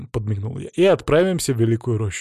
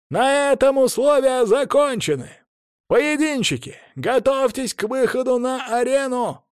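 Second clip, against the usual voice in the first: herald shouting; 12 semitones higher than usual. A narrator's reading. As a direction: summon the fighters to the arena. Herald calls out fighters.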